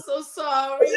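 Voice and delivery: ordinary talk, nothing marked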